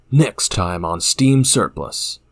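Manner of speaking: slow, drawn-out show outro; announcer voice. Shown as a demonstration. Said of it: "now say it like you're eating".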